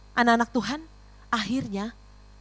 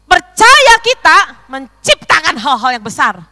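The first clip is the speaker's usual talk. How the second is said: loud, high proclamation; forceful raised sermon voice; full-force preaching; 6 semitones higher, 16 dB louder than usual. Real, not acted. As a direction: thunder it out to the congregation.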